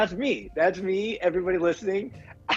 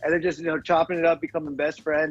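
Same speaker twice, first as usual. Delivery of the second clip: ordinary talk, nothing marked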